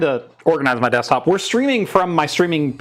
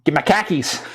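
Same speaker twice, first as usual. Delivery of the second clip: put-on Boston accent. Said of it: "where the Boston accent came from".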